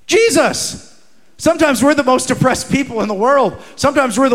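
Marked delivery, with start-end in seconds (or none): 0.1-0.8 proclaimed answer. Shown as a demonstration. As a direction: lift "Jesus" high and proclaim it. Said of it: "Can I tell you what Christians need more than ever?".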